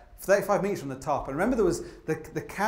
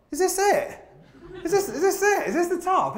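much higher, stunned questioning; incredulous disbelief; 13 semitones higher than usual. Retold or imitated. Climber reaching the summit, stunned incredulous questions. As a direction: ask it in baffled disbelief. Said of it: "I thought".

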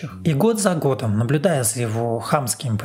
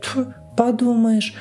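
high, slow dismissive quote; dismissive colleague; 9 semitones higher, slower than usual. Retold or imitated. Narrator retelling a colleague's reply, dismissive. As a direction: imitate an unimpressed colleague in a high voice. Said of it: "моя коллега отвечает".

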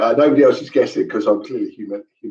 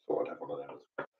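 quiet aside; 9 semitones lower, 20 dB quieter than usual. Real, not acted. Muttered aside to himself.

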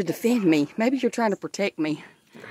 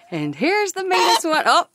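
pitched way up, mock dramatic reveal; high teasing announce; 10 semitones higher, 4 dB louder than usual. Real, not acted. Introducing the meanest hen to viewers, high and teasing. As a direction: present the mean hen in a high sing-song.

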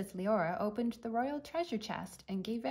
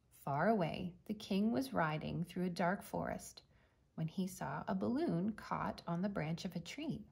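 ordinary delivery

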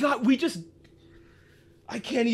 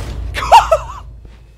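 shrieking laugh; 8 dB louder than usual. Real, not acted. Cackle during the reveal.

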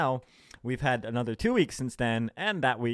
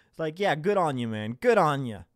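ordinary talk

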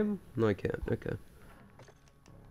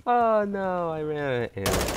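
high start sinking steadily to usual pitch; deflated lament; 5 semitones higher than usual. Real, not acted. Gamer out of ammo after losing, deflated sinking moan. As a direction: let the wail slide down into defeat.

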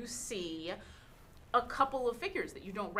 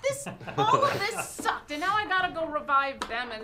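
high, loud, exasperated gnome rant; high squeaky gnome complaining; cranky gnome cleric; 8 semitones higher, 6 dB louder than usual. Acted. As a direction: whine it like a fed-up little cleric. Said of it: "the small little gnome says".

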